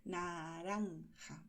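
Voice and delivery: Naranja very slow, tongue pushed to the teeth; exaggerated tongue movement. Shown as a demonstration. Teacher exaggerating Naranja so learners see the tongue. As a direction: overarticulate Naranja slowly. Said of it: "Si yo exagero el movimiento".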